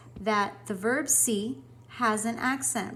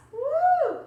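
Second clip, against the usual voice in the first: cheer; 8 dB louder than usual. Real, not acted. Celebrating last video.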